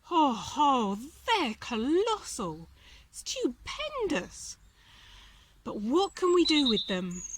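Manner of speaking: amazed mouse. A narrator's reading. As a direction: marvel at the huge ears. Timothy marvels at ears.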